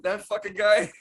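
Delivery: high, loud, rising question; incredulous. Acted. Agreeing with co-host about the roommate, incredulous. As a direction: echo it in amused disbelief. Echoes the disbelieving reaction.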